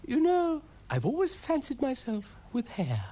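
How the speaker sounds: high, fluting character voice; high-pitched self-admiring lady; vain lady character. Acted. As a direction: confide it in a high, airy lilt. Ermyntrude confides a vanity.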